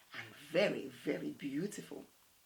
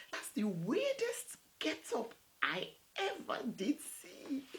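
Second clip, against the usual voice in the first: laughing; 6 semitones higher than usual. Acted. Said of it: "she choked".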